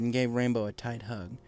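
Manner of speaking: ordinary delivery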